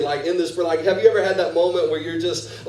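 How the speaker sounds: usual speaking voice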